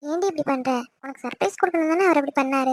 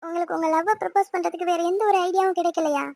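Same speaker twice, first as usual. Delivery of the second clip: crying and scolding; 4 dB quieter than usual. Acted. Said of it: "அழுகாதடி".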